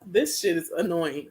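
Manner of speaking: low, subdued irritation; real low-key annoyance; annoyed. Real, not acted. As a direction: grumble it softly, fed up. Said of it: "'cause I'm annoyed now".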